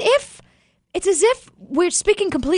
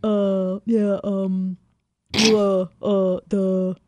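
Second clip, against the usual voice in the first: slow, halting dopey grunts; dumb guy impression; 5 semitones lower, 3 dB quieter, slower than usual. Mocking her husband as a slow, dumb caveman type. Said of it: "Whenever I do an impression of you".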